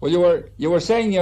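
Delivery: ordinary talk, nothing marked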